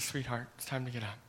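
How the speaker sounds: put-on Jesus softly waking the girl, tender; tender pet name. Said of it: "more of a pet name".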